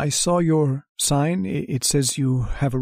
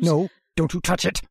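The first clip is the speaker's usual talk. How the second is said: a sudden sharp no, pitched up; sharp warning; 5 semitones higher than usual. Acted. Lodger warns the landlady off his bag, sharply. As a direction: snap at her to keep her hands off.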